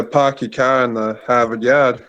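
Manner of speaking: Boston accent, every R dropped; don't say a single R, Boston style. Shown as a demonstration. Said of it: "do another thing in that accent".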